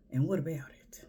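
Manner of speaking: whispered and unvoiced, falling on what; sassy whisper. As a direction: breathe it out as a dry challenge. Sassy retort to a man.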